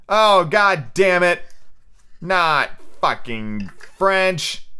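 dragged-out annoyed swearing; real exasperation. Real, not acted. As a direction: curse slowly at the page, each word stretched. Reader annoyed at French line.

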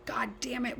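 high whispered angry hiss; hissed mom. Retold or imitated. Mother hissing at kids to get shoes on. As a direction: hiss at the kids under your breath. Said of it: "I remember being like".

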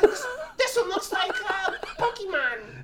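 high squeaky put-on voice; drawn-out high cartoonish voice; silly family member. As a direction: play a gleeful relative in a tiny high voice. Plays family member in car.

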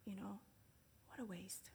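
hushed disgusted aside; whispered grumble. Retold or imitated. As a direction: mutter it under your breath. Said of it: "It's like".

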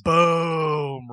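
long booming "boom", loud; hyped boom. Real, not acted. Podcaster hyping Luke Harper's surprise entrance, drawn-out loud boom. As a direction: draw out a big "boom".

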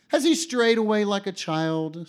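high, loud taunting question; a mocking bystander calls out; mocking jeer. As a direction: sneer at him like a jeering crowd. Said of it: "The people cried out laughingly".

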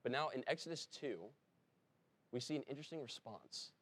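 usual speaking voice

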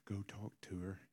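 hushed imitation of a wife's prompt; quiet prompting. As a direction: gently nudge someone aside. Wife quietly prompts him afterwards.

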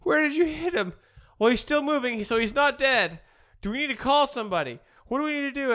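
high, loud worried voices; panicked. Retold or imitated. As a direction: act out their panic. Voicing panicked friends.

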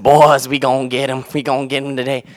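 imitated pep talk, high and very loud; fired-up older coach. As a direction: holler like an excited old coach to his players. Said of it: "he'd go like this".

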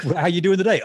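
high, loud retold greeting; imitated cheery check-in. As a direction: pitch up a mock bright question.

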